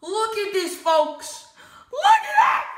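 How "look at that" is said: very high, loud laughing yell; real fan's squeal; excited shriek. Real, not acted.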